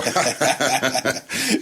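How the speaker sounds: amused burst of laughter; real laugh. Real, not acted. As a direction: laugh freely at your own remark. Laughs after blessing host's mother.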